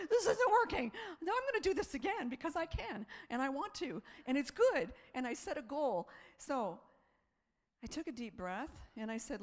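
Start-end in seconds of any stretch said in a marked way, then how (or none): 0.1-4.9 high, loud resolve; determined outburst. Retold or imitated. Standing in her kitchen, vowing to run again, loud and resolved. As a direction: declare it with fresh determination.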